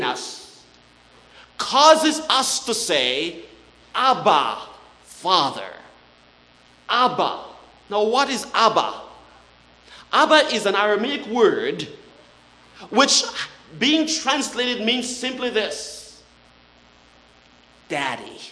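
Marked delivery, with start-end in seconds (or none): 3.9-7.4 tender cry. Real, not acted. Spirit's cry quoted. 17.9-18.4 low, soft Daddy; tender hush. Real, not acted. Preacher gently revealing what Abba means.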